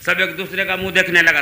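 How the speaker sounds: usual speaking voice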